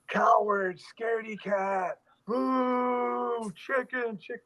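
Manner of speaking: high drawn-out heckling; mock crowd screaming. LA crowd heckling the stuntman on the ledge, mock screaming. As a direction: jeer in a high, stretched-out mock yell.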